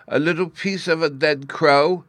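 put-on high, mildly plaintive little boy; acted mournful wail. A narrator's reading. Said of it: "was Bruno's mournful reply".

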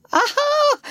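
very high exclamation of triumph; delighted cry of recognition; triumphant aha. A narrator's reading. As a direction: pounce on the answer. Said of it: "cried the doctor".

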